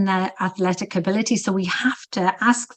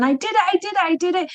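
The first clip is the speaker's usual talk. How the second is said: much higher, squealing mock joy; imitating a thrilled client; 10 semitones higher, faster than usual. Coach retelling her client's breakthrough after twelve rows, squealing imitation. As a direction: replay her giddy, teary triumph, pitched way up. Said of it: "jumping up and down and crying, going".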